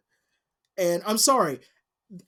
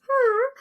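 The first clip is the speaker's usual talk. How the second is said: mock creature squeal. Baby alien sound in retold scene.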